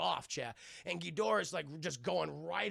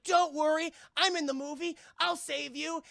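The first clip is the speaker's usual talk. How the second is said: high, loud mock heroine; hammed-up hero voice; heroic rescuer; 10 semitones higher, 7 dB louder than usual. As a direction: swoop in to save him. Voicing Sally Hawkins heroically.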